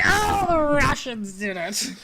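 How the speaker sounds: slow, high, loud hammed-up cry; hammed-up blame, drawn out; mock accusation. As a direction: play the panicked accuser for laughs. Mocking blame-the-Russians joke.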